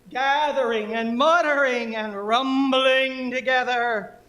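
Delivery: loud, high, very slow incantation; poem performance. Acted. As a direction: stretch the vowels like a booming rain god.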